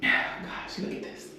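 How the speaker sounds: hushed, voiceless wonder after a sigh; awed whisper. Real, not acted. Traveler marvelling at her shower, whispering in awe. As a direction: whisper it, overcome.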